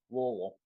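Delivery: "Louisville" slurred into a muffled "LUH-vul"; mouthful-of-food pronunciation. Shown as a demonstration. Correcting friends as a local taught him, "Louisville" mumbled mouth-full. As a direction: say "Louisville" as if through food, middle swallowed. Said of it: "like you have a mouthful of food".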